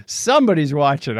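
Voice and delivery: ordinary talk, nothing marked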